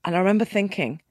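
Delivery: ordinary talk, nothing marked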